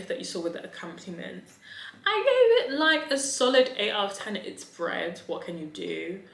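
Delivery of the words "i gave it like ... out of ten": high, loud excited announcement. Acted. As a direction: announce it with delight. Enthusiastic score reveal.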